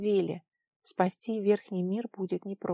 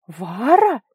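loud, startled exclamation; startled cry; 3 semitones higher, 12 dB louder than usual. A narrator's reading. A voice in the fog recognises Vara, crying out. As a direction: cry out the name in astonishment. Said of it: "вскрикнул голос".

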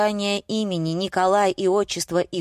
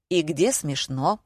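usual speaking voice

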